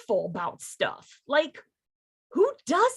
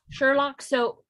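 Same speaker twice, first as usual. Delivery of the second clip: ordinary talk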